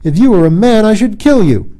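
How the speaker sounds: loud high furious threat; wrathful. Acted. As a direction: threaten him in a rage. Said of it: "in wrath".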